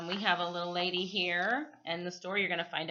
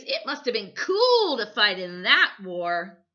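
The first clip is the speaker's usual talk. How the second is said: exclaiming; 2 semitones higher, 9 dB louder than usual. Acted. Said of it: "Michael exclaimed".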